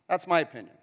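normal talking voice

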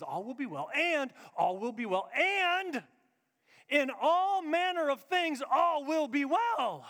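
slow build to a loud, high finish; rising triumphant declaration; building proclamation. Shown as a demonstration. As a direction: swell each phrase to a climax. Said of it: "She says".

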